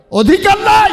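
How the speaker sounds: very high, very loud cry; emphatic shout. Real, not acted. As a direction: belt it out at the crowd. Preacher cries out the denial. (তীব্র চিৎকার)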